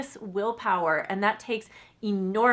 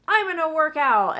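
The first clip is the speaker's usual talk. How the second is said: mock eager vow; 7 semitones higher, 6 dB louder than usual. Quoting an overeager new resolution.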